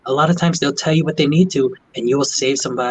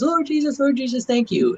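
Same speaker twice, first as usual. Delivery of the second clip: high imitated praise; thankful patient; 12 semitones higher than usual. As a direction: go high, mock rapture. Patient thanking God again.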